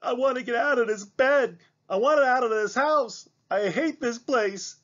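high, wailing complaint; girl crying to get out of bed; tearful girl. Acted. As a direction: sob out the hatred, high. Mallory sobbing, trapped.

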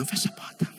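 whispered insistence, voiceless; suggestive whisper. Retold or imitated. Doctor to patient, insisting in a whisper. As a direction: hush it like a secret invitation.

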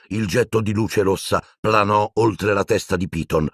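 ordinary delivery

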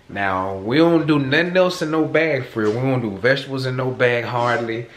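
usual speaking voice

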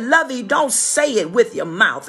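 usual speaking voice

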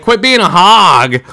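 a milder, retold version of the scolding; mock repeat of his own outburst; replaying his yell. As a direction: quote yourself half as hot. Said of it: "and I'm like".

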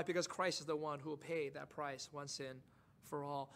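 ordinary delivery

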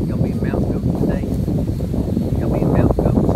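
loud call across the yard. Real, not acted. Calling out loudly to the goats on the rocks.

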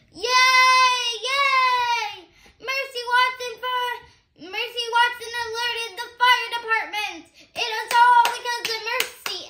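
high, loud cheer. Acted. Firemen cheer Mercy for alerting them, high loud cheering. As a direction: celebrate the hero at the top of your voice.

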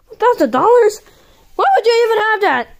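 high, fast disbelief; incredulous. Pretend. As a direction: scoff at the price. Astonished at cheap price.

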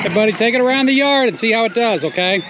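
high, quick call across the yard. Real, not acted. Grandpa calls out to grandson on the ride-on, high quick call.

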